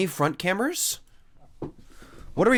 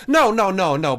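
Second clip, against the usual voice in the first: louder, higher mock no; loud refusal; 4 semitones higher, 6 dB louder than usual. Streamer mock-rejecting casual mode, loud no.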